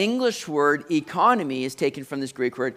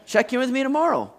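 impatient parent; 6 semitones higher than usual. Retold or imitated. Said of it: "I'm like".

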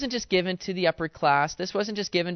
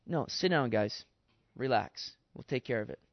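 calming the crowd; 5 semitones lower, 6 dB quieter than usual. Acted.

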